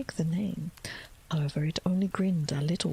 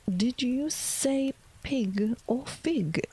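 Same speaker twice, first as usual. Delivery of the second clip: slow whispered cat voice with a lift; drawling Cheshire Cat; 5 semitones higher, much slower than usual. Acted. Cat reappears to tease Alice, slow whispered drawl. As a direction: stretch it out lazily as the grinning cat.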